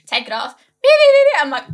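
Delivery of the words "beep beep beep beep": high, loud, rapid beeping; imitated scanner beeps. Setting off the airport security scanner, mimicked alarm beeps.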